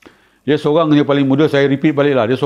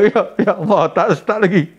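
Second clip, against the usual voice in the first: mock dismay; 5 semitones higher than usual. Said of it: "Dia kata".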